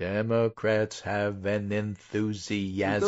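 drawn out syllable by syllable; deliberately slow. Shown as a demonstration. Announcing a poll finding to co-host, deliberately slowly. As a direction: say it very slowly, spacing every word. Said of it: "I'll say it slowly".

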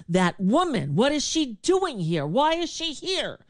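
high, indignant mock rant about her; mock outrage. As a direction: pitch up and play him sputtering with outrage. Mocking Dennis Miller's angry outburst.

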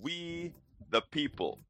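high, rousing radio host delivery; show-opening announcer. Acted. Host greets Houston and the world, rousing announcer.

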